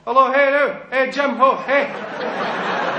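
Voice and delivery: high, loud "Jim, ho, hey" with the "hey" held back; delayed Glasgow "Jimmy". Shown as a demonstration. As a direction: call out "Jim", putting "hey" off till the end. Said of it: "they don't say the "eh" and Jimmy until a wee bit later".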